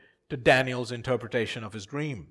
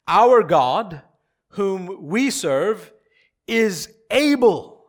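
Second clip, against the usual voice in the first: loud, slow, raised-pitch proclamation; emphatic declaration; 4 semitones higher, 7 dB louder, much slower than usual. Acted. Preacher voicing the three men's answer to the king, emphatic. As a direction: proclaim it slowly, leaning on each phrase.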